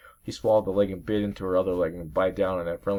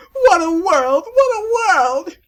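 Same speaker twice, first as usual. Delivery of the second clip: very high, loud crying wail, second one very slow; wailing in a shrill falsetto like a sobbing villain; hammed-up high crying; Steve Magnus; 13 dB louder, much slower than usual. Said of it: "I gotta do Steve Magnus' voice".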